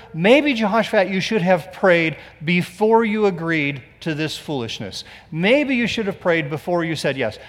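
ordinary delivery

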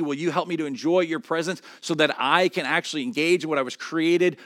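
loud and high throughout, urgent and pleading; preacher voicing David's prayer; impassioned preaching.

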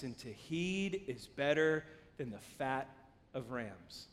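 slow, low, hushed reading; measured, weighty softness; solemn scripture reading. Acted. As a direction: read holy words gravely, unhurried. Reads scripture aloud solemnly.

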